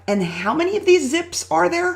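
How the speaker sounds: high, alarmed voice that suddenly realises how many zips are left; panicky question. Retold or imitated. Retelling the zip line guides, panicked and high.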